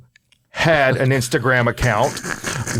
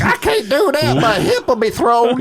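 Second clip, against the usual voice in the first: put-on high, quavering old lady; old woman voice; 9 semitones higher than usual. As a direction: play a frail old woman worried about her hip. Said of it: "that's my old woman voice".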